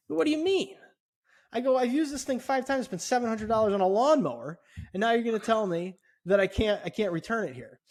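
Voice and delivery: angry customer arguing a refused return; retold anger. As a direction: push back at the clerk, voice climbing. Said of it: "now I'm getting aggravated".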